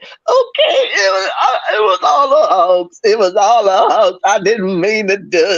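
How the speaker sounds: high-pitched, hammed-up whimpering; mock confession. Imagining the woman confessing to the hoax, mock-tearful and high. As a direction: imitate a woman tearfully cracking under questioning. Acts out her future confession.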